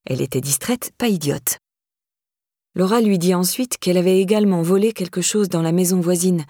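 usual speaking voice

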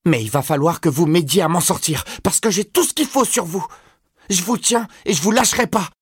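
put-on very high boy's voice, threatening; high-pitched threat. A narrator's reading. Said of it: "cria Todd d'une voix aiguë".

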